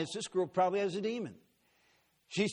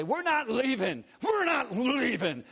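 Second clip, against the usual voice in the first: high, loud, slow defiant shout; defiant demon; 7 semitones higher, 4 dB louder, slower than usual. Retold or imitated. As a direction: refuse to leave, dragging words. Demons defying the preacher.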